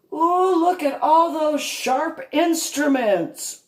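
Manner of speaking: high, slow gleeful villain thoughts; mock menacing glee. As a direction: savor the idea like a cartoon villain. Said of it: "in my thoughts".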